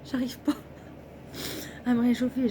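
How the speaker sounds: trembling with cold; shivering voice. Real, not acted. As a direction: let the cold shake the words. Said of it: "J'en tremble".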